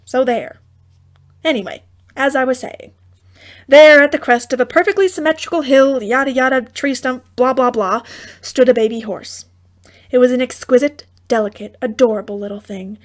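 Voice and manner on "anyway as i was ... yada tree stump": ordinary delivery, nothing marked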